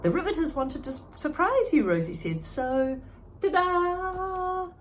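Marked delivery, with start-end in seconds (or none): 0.0-1.8 put-on eager kid. Rosie explains surprise. 2.4-4.7 high, slow drawn-out ta-da; ta-da flourish. Acted. Rosie reveals gift.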